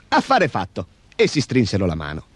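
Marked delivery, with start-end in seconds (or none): none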